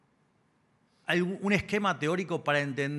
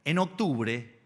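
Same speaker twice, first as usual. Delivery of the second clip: booming balcony speech; 4 semitones higher, 4 dB louder than usual. Acted. Campaign speech before the lecture.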